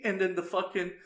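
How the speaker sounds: normal talking voice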